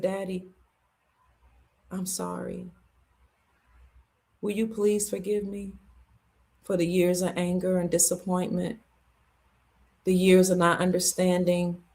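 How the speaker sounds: soft, low and sincere; hushed apology. Retold or imitated. Daughter asking her dying daddy's forgiveness, hushed apology. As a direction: ask forgiveness quietly, keeping the voice low.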